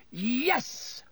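high, held-back cheer; triumphant yell without much volume. A narrator's reading. Ron celebrating Harry's win, yelled. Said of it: "Ron yelled".

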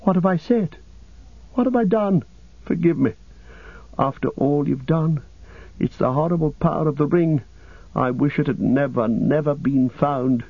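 high, remorseful pleading, gradually easing; anguished cry. Acted. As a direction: plead for forgiveness, pitch high with distress. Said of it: "cried Frodo".

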